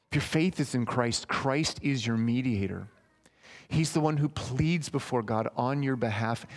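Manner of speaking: ordinary talk, nothing marked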